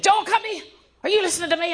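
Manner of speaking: high-pitched mock mom yell; mother shouting. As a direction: holler at the child like a fed-up mother. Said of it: "Instead of saying".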